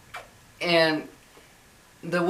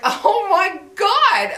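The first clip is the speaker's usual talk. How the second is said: high, drawn-out, louder gasp of disbelief; retold shock, pitched way up; shocked reaction; 14 semitones higher, 6 dB louder, much slower than usual. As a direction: stretch it out high in exaggerated disbelief. Retold shocked reaction to rude husband.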